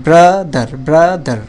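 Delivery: Brother with bra held, then দার; each brother split into two falling beats; two-part word. Shown as a demonstration. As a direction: divide brother after a long bra. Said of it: "দুইটা অংশ".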